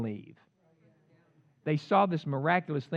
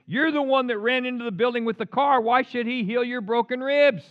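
loud, high accusation; mock scolding, pitched up and loud; accusing voice; 10 semitones higher, 8 dB louder than usual. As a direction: hurl it as a raised-voice reproach. Voices the accusing inner thought.

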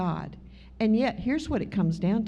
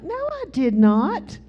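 higher, louder, slow indignant protest; indignant denial; 6 semitones higher, 4 dB louder, slower than usual. Retold or imitated. Woman protests to God she never called him liar, indignant. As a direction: deny it hotly, word by word. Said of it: "I'm like".

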